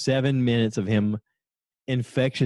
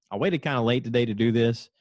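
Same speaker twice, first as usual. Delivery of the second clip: ordinary delivery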